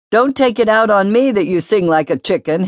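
put-on a small boy objecting indignantly; little brother sulkily protesting to the older boy; Hamnet protesting. A narrator's reading. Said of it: "Hamnet protested".

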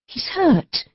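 Aline, alarmed. A narrator's reading. Woman voiced in a story.

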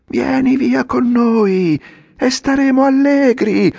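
put-on a whole cartful of boys roaring together; hundred voices yelling. A narrator's reading. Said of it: "urlarono tutte insieme un centinaio di voci". (urlato da cento voci, altissimo e forte)

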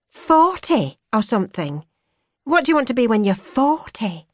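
put-on young woman musing aloud; young woman's voice. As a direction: voice her bright and searching. She asks, higher voice.

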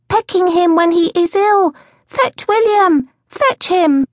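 put-on very high, drawn-out child's wail; high childish urgent plea; upset little boy. A narrator's reading. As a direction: call for help as a distressed toddler. Upset small boy calls for help.

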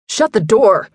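understated yelled command; mild yell. A narrator's reading. Phil to Tyler at the open door, mild yell. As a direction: call it out with a contained yell. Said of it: "Phil yelled".

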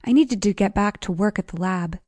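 usual speaking voice

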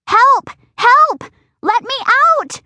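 high, loud desperate yelling; screaming for help. Acted. Nobody comes upstairs, she screams again, high and loud. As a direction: scream it over and over. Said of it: "I scream over and over again".

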